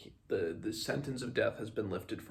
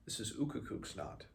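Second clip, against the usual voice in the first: hushed, reverent whisper; quiet whisper; 4 semitones higher, 5 dB quieter than usual. Acted. Binabik to Simon over the sacred knot, whispered. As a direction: breathe it out like a secret. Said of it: "he said quietly to Simon".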